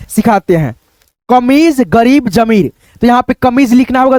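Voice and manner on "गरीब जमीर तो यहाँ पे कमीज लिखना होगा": normal talking voice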